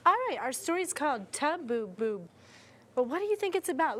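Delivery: ordinary talk, nothing marked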